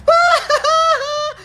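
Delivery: high loud shrieking cackle; shrieking laugh. Real, not acted. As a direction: burst out in a squealing laugh. Mocking rival's legal move. (malakas na tiling tawa)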